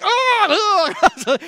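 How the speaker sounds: loud, high wail of revulsion; mock disgusted groan. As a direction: imitate teachers recoiling from a stench. Teachers recoiling from the stink.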